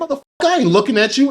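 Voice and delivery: fast, high-pitched indignant comeback; defiant retort. Retold or imitated. Retold defiant response to threat.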